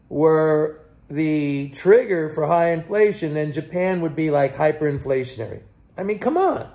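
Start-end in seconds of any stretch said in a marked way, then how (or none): 6.0-6.7 higher, incredulous I mean, come on; exasperated. Real, not acted. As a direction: throw it up in disbelief. Exasperated at rate logic.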